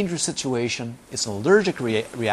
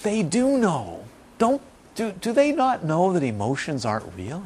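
quoting his teacher; 6 semitones higher than usual. Retold or imitated. Teacher voicing his dying master asking about students. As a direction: ask it like a puzzled old master. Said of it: "he says".

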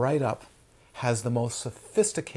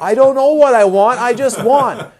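mock child's scream, much higher and louder; 10 semitones higher, 15 dB louder than usual. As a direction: yell it like a frustrated toddler. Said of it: "They scream at you".

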